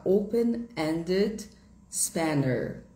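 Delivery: open-ended spanner said slowly, British style; teacher gives the British term; British English term. Shown as a demonstration. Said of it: "No inglês britânico".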